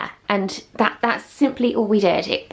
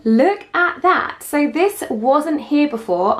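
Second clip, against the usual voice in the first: gushing makeover reveal; 6 semitones higher, slower than usual. Real, not acted. Reveal of the new cabinetry.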